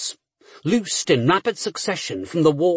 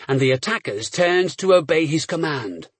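usual speaking voice

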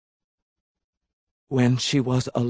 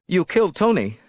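higher-pitched, controlled accusation; 7 semitones higher, 3 dB louder, faster than usual. A narrator's reading. Elizabeth confronting Tony's killer, tense accusation. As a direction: accuse him, voice raised in pitch but held. Accuses him, first take before scream.

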